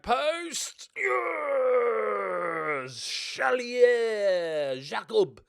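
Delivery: long, high, loud roar of triumph; goal celebration. Real, not acted. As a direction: yell the goal and the scorer's name, drawn out. Goal celebration in a football game.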